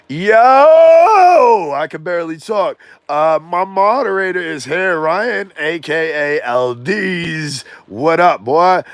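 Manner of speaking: loud, high excited greeting. Real, not acted. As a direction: shout hello to a friend. Greeting his moderator loudly.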